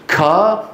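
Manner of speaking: كا, air pouring out after the k; exaggerated breathy release on كا; over-aspirated kaf. Teacher demonstrates the faulty kaf, over-aspirated. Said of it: "كمية من الهواء تتدفق".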